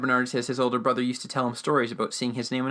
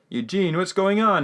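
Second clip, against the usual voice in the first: raised, louder concerned question; Wit; 7 semitones higher, 5 dB louder than usual. Retold or imitated. Wit questions the dazed Eugene, imitated concern in a raised voice. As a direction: call out to someone who is in a daze. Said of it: "And Wit's like".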